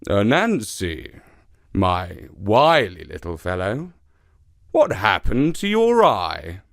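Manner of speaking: lounge on the words like a big cat; lightly voiced lazy leopard.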